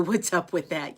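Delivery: usual speaking voice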